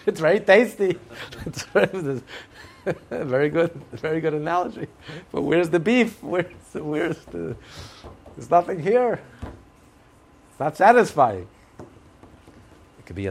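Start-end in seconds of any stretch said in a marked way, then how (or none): 0.1-4.9 laughing. Real, not acted. Laughing mock reply. 5.3-11.5 mock complaint. Mock complaint of missing substance.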